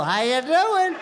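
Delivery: high, flirty come-on voice; cheesy pickup line. Retold or imitated. Husband hitting on his wife in labor, cheesy flirt voice. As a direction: slide in like a smooth pickup artist.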